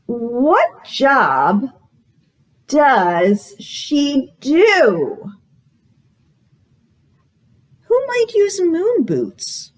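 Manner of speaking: bright, sing-song storytime read; reading to children. Acted. Librarian reading a guessing book to children, sing-song. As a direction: read it like a picture book to little kids.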